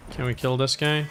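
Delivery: ordinary talk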